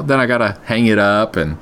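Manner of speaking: ordinary talk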